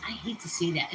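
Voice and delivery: breathy whisper under her breath; whispered aside. Real, not acted. To her viewers about bacon grease, a whispered aside. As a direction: confide it in a hush.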